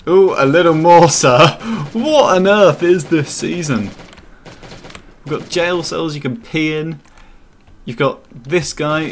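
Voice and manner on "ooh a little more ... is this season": loud, higher put-on gentleman voice; hammed-up posh gent. Mocking the peeing drunk friend in a loud toff voice. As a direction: boom it out like a shocked toff. Mock voice while character pees.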